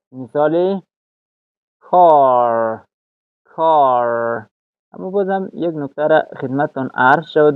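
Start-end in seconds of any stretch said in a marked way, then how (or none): 1.8-4.5 very slow "کار" with a stretched "ah" before the R; long vowel demo. Shown as a demonstration. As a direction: draw out "کار" slowly, keeping the R. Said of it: "صدای کشیدهٔ".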